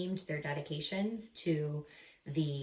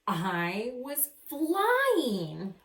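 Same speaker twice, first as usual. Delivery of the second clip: stretched out, louder and higher; thrilled; 5 semitones higher, 4 dB louder, much slower than usual. Acted. Amelia remembering the ride, slow and elated. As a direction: savour it like soaring.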